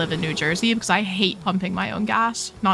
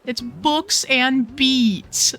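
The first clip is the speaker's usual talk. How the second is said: drawn-out savor; 4 semitones higher, much slower than usual. Real, not acted. Savoring the store name.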